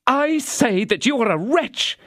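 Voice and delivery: high, cutting contempt; wife scorning her husband; scornful. A narrator's reading. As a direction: spit out wretch. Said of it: "recovering some influence".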